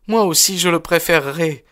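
very high, energetic daughter's voice; high-pitched, spirited girl; energetic young woman. A narrator's reading. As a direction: answer firmly in a high female voice. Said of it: "répondit-elle avec énergie".

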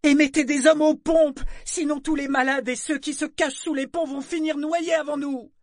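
high urgent yell, easing in volume; yelled order. A narrator's reading. Boy ordering men to the pumps, yelling. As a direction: keep screaming orders over the storm. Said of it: "hurla-t-il".

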